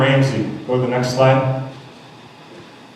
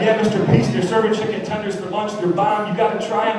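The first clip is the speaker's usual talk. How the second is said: higher, lightly voiced eager little boy; eager kid; 7 semitones higher than usual. First grader telling Mister Peace about chicken tenders, lightly higher and eager. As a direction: chirp it like a kid excited about lunch. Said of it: "He said".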